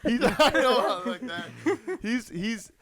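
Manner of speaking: high laughing, slowed; laughing, high and loud. Real, not acted. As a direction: laugh through the words. Laughing about Bellini.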